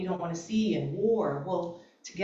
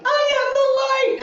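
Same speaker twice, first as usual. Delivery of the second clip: very high, loud mock scream-cry; 11 dB louder than usual. Retelling her hard week, screaming and crying at herself. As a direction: wail the affirmation in despair. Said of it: "as I was screaming and crying".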